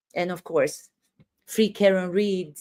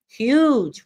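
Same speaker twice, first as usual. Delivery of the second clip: HUGE blown up, higher and louder; mockingly inflate the word "huge"; "huge" punched high for overblown effect; mock exaggeration; 4 semitones higher, 5 dB louder than usual. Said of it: "We're gonna exaggerate it".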